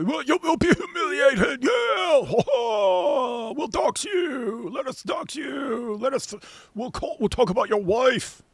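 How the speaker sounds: shrill-pitched mocking cry, words stretched out; hammed-up high wailing taunt; mock threatening. Host parodying Dawa debaters threatening their opponents, high mocking wail.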